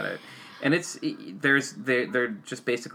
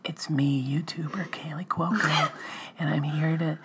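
ASMR whisper; 6 semitones higher, slower than usual. Pretend. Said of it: "A S M R, baby".

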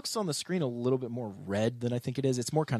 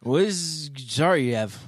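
put-on slow slurred drunk question; 3 semitones higher, 5 dB louder, slower than usual. Shown as a demonstration. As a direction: drag the words out like a drunk. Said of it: "the drunk voice that we have".

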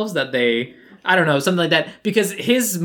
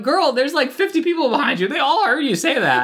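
incredulous; 10 semitones higher than usual. Retold or imitated. Mock scolding the skater.

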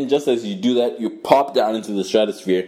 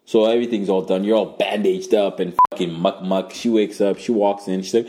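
ordinary talk, nothing marked

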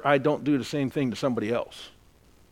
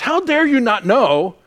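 indignant; 8 semitones higher, 7 dB louder than usual. Retold or imitated. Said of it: "my first thought was".

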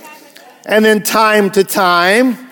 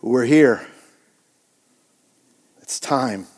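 lower, softer, heavy resolve; grim hush; 6 semitones lower, 6 dB quieter, slower than usual. Retold or imitated. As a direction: say it quietly, dreading what comes. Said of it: "he's like".

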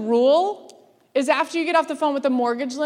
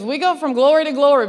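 louder, punchy preaching quote; preacher quote; 3 semitones higher, 8 dB louder than usual. Retold or imitated. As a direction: belt the bishop's line like from the pulpit. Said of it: "he goes".

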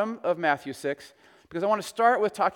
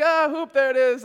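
put-on kids cheering; 7 semitones higher, 5 dB louder than usual. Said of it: "all the kids are like".

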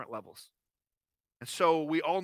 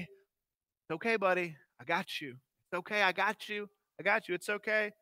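gentle comforting; 4 dB quieter than usual. Retold or imitated. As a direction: hush him like you're holding him close. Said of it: "hold him close, and say".

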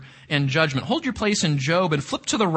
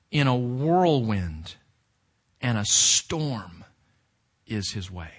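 hushed slow scripture reading; 6 semitones lower, slower than usual. Acted. Reads storm verse hushed.

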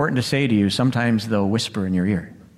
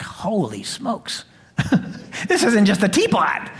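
amazed mom; 7 semitones higher than usual. Retold or imitated. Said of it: "my mom's like".

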